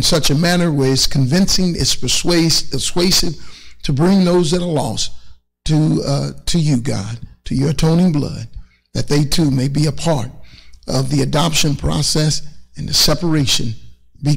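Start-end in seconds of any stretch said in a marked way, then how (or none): none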